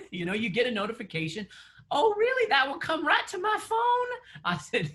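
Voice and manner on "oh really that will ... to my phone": much higher, surprised Southern mom; Southern accent. Retold or imitated. Mom amazed by phone notifications, mock Southern accent. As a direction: pitch way up like an amazed Southern mother. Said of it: "She has a Southern accent".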